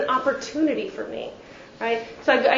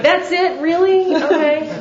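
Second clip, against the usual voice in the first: higher, louder hammed-up shock; mock tearful disbelief; 5 semitones higher, 4 dB louder than usual. Speaker mocks her own dramatic shocked reaction to stepfather, higher. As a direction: play it as dramatic wounded surprise. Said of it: "and I'm like, tears".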